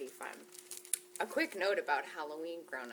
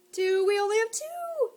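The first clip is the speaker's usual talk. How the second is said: surprised; 12 semitones higher, 10 dB louder than usual. Acted. Surprised counting.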